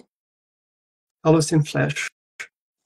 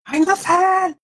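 hammed-up shouted order, much higher; mock German shout; 14 semitones higher than usual. Streamer parodying German as barked commands, mock shout. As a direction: yell "Ein Befehl!" like a drill officer. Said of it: "sounds like sehr aggressiv".

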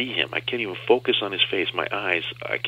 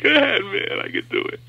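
much higher strained cry of defiance; 13 semitones higher, 4 dB louder than usual. Retold or imitated. Patient to doctor while bawling, strained high. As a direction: push through the pain, voice cracking high. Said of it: "still crying and bawling".

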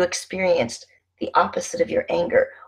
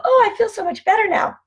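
much higher, mocking sing-song of instant relief; 4 dB louder than usual. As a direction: parody someone pretending it's all fixed. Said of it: "you're not just gonna go".

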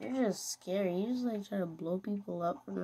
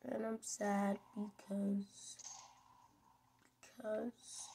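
fake sad; 3 semitones higher, 9 dB quieter, slower than usual. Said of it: "I'm just kidding, guys".

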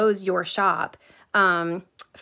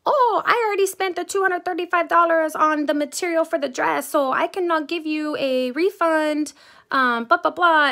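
high, put-on voice of the shop lady mid-rant; mock yelling seamstress; 8 semitones higher, 4 dB louder than usual. Mom mocking the seamstress who yelled at her, pitched high. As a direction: imitate her losing it, pitched up. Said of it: "loses her marbles on me and starts yelling".

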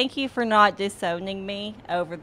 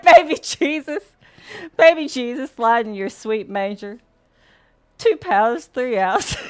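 film quote; 4 semitones higher, 9 dB louder than usual. Retold or imitated. Quoting a comedy film's dinner prayer.

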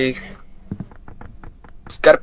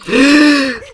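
loud high startled gasp; sharp gasp; 8 dB louder than usual. Real, not acted. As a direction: gasp in fright. Monster jumps out. (głośny, wysoki, przestraszony wdech)

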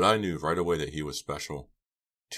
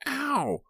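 high-pitched yelp; cry of pain. A narrator's reading. Pam as hands dig into her waist, pained scream. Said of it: "She screamed as both of his hands".

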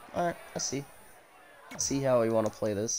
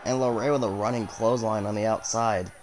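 lively ringside play-by-play; wrestling commentator; 3 semitones higher than usual. Acted. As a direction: call every move as it lands. Calls the match live.

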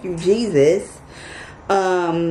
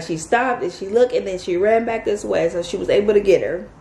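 ordinary talk, nothing marked

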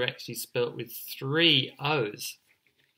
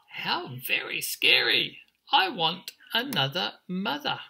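high-pitched, swooping chick voice; chirping chick; 8 semitones higher, 3 dB louder than usual. Acted. Scared emu chick rejects what he saw, high chirp. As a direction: chirp it like an alarmed baby bird. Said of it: "and he chirped".

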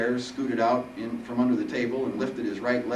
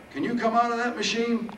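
put-on high, loud old-man voice; 14 semitones higher, 4 dB louder than usual.

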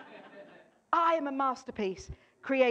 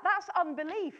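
usual speaking voice

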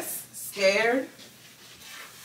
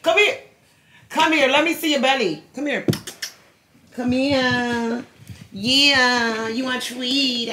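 much higher, louder pet-talk voice; 5 semitones higher, 6 dB louder than usual. Real, not acted. Owner praising her dog Denim while grooming, high cooing baby talk. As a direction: coo at the dog like a doting mom.

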